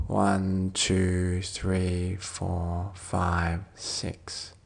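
low, softening count, drawn out; soft breath count. Real, not acted. Counting six for the listener's out-breath, soft and fading. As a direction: count down the exhale, fading to a whisper.

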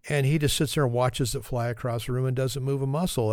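normal talking voice

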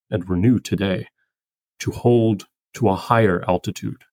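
slow, measured poem recital; poetry reading. Acted. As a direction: read the poem with gentle pauses. Reads the poem aloud.

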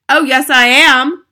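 slow, loud, high cheeky reply; boy piping up. A narrator's reading. Mouse boy poking out of the bag, loud and high. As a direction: pipe up loudly to contradict Dad.

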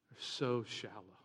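ordinary talk

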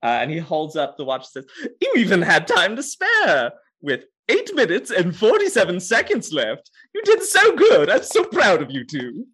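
high, laughing, delighted host; gushing game master. Acted. Game master praising the players, high and laughing. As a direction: gush with praise through laughter. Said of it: "the clapping form of the game master".

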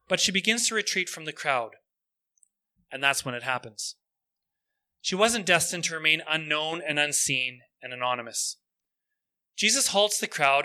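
ordinary talk, nothing marked

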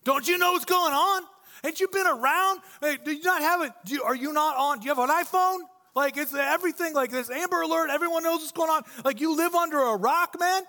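high, loud disbelieving rant; incredulous Cleo. Retold or imitated. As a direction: scoff at the stranger who knows nothing. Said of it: "and Cleo's like".

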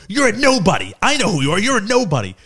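high-pitched mock taunt; scornful retort, acted out high; retold put-down. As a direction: imitate dad dismissing a stranger. Imitating dad insulting the woman.

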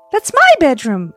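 put-on high, loud surprised child; surprised little girl. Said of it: "exclaimed Lucy in surprise".

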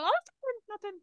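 high, breezy cover-up, pretending all is fine; fake calm. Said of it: "I was very calm, very patient".

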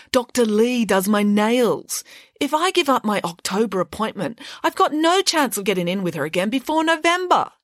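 put-on high, haughty girl's voice; spoiled schoolgirl. Mirabella scoffs at Mister Nesta, high and haughty. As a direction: talk down to the teacher.

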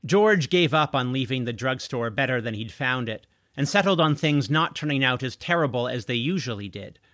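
ordinary delivery, nothing marked

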